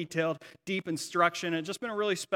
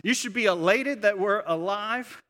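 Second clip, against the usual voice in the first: higher-pitched, mildly raised angry protest; retold indignant yell; 4 semitones higher, 5 dB louder than usual. Child yelling back at parents in front of everyone, retold indignant yell. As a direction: retell an outraged outburst at parents. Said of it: "yelling at them in front of".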